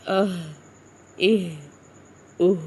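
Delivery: "اہ" ending in an airy h from the chest; short vowel, then breath, on each "اہ". Shown as a demonstration. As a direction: breathe out the final h of "اہ" from deep down. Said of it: "سینے سے آواز".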